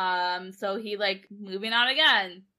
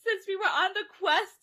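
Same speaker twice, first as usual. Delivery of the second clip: high, half-laughing fake sob through the words; mock tearful confession; 9 semitones higher than usual. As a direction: play a blubbering lovesick girl, pitched way up. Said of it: "And she's crying".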